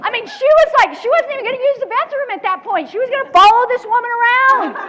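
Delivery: loud laughing insistence; laughing excitedly. Real, not acted. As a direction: burst out laughing with disbelief.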